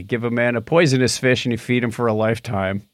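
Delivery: usual speaking voice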